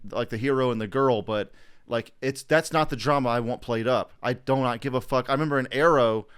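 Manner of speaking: normal talking voice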